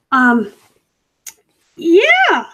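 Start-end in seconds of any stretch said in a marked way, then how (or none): 1.8-2.5 squeaky high yeah; high-pitched. Real, not acted. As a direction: pitch it way up to sell a fib. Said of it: "I said that all high-pitched".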